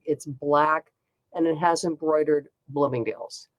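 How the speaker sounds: usual speaking voice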